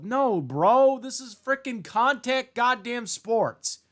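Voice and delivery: angry outburst. Real, not acted. Vlogger shouts at flag football backers, exasperated. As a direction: blast back at them.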